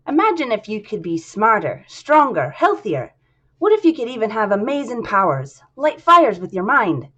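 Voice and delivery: hammed-up Irish accent, selling powers; bad Irish accent. Atlas pitching plasmids in a bad Irish accent. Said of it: "my really terrible attempt at an Irish accent".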